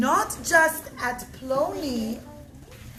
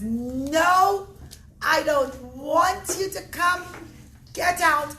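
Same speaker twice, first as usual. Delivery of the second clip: loud, higher furious refusal; angry shout; 4 semitones higher, 5 dB louder, much slower than usual. Acted. Host kicking Bar Kamsa out, loud angry shout. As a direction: kick him out with your voice.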